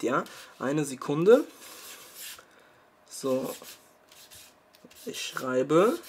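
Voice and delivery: ordinary delivery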